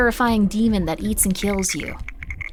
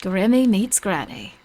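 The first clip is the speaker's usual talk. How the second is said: British TV presenter; 3 semitones lower than usual. Pretend. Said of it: "what that one British woman".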